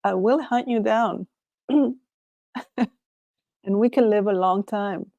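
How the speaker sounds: ordinary delivery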